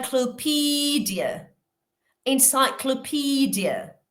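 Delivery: slow high model of Encyclopedia; model pronunciation. Shown as a demonstration. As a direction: stretch Encyclopedia, pitch up. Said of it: "la última".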